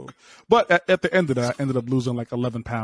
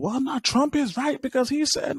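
a high mock Steve Harvey voice; imitating Steve Harvey; 11 semitones higher than usual. Mocking Steve Harvey's words to the crew, high mock voice. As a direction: lampoon him, pitched way up. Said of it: "he was talking about".

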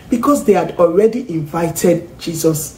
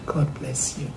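whispered blessing; 3 semitones lower, 11 dB quieter than usual. Shown as a demonstration. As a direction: bless them in a hush. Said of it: "whispered blessing".

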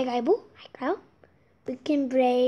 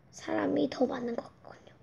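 whisper; 7 dB quieter, faster than usual. Real, not acted. Whispered aside about answers.

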